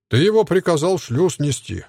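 ordinary delivery

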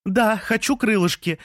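very high called reply; shouting back. A narrator's reading. Lea answering Jean's breakfast offer, high shout back. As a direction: holler a yes back to him, pitched right up. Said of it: "кричала в ответ Лея".

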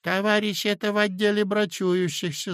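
secretary piping up; a small, squeaky official voice; squeaking. A narrator's reading. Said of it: "запищал секретарь".

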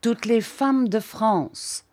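slow, drawn-out shout; slow proclaim. A narrator's reading. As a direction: stretch out the boast. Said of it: "cria-t-il".

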